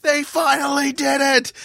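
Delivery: high, drawn-out joyous cry; ecstatic retelling. Retold or imitated. Fan telling the bar his team won, high elated cry. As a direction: stretch out the victory shout. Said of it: "And I'm like".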